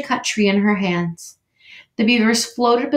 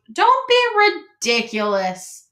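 slow, higher scolding wife; exasperated interrupt; 7 semitones higher, 4 dB louder, slower than usual. A narrator's reading. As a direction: cut him off, fed up. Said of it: "interrupted Missus Beaver".

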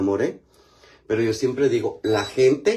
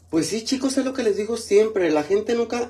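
preachy advice; 12 semitones higher, 3 dB louder than usual. Real, not acted. Preachy life advice to viewers.

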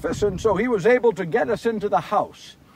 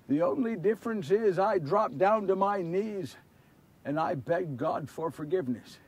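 soft, sober reflection; subdued confession; 2 semitones lower, 7 dB quieter than usual. Acted. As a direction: state it quietly, with humility. Calm look back at begging.

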